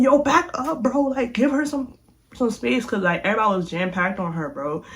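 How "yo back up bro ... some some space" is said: firm order. Retold or imitated. Speaker ordering crowd back.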